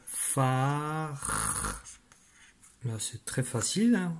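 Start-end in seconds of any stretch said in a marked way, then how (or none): 0.0-1.8 long held vowel into a lingering R; drawn-out syllable. Shown as a demonstration. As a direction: stretch the syllable so every sound is heard. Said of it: "première syllabe". (syllabe très étirée, R prolongé)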